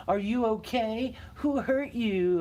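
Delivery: high, slow, sing-song taunt; sarcastic mock sympathy to rub it in; mocking fake concern. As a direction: drag out "Who hurt you?" to needle them. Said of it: "to antagonize you".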